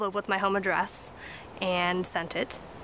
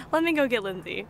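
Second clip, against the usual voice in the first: usual speaking voice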